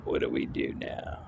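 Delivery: breathy conspiratorial whisper. Pretend. Plotting his next fight move, he whispers to himself. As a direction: lean in and whisper the question.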